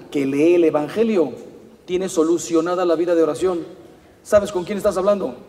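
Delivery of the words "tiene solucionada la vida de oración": ordinary talk, nothing marked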